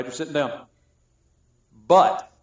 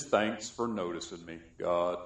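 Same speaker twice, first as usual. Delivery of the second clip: low, soft, slow mopey sigh of a voice; a gloomy believer moping to God in a low, soft voice; Eeyore moment; 8 semitones lower, 9 dB quieter, much slower than usual. Retold or imitated. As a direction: droop it like a sad donkey. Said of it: "an Eeyore moment".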